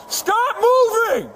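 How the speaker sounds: shouted command. Real, not acted. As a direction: bark it at the suspect. Officer commanding suspect.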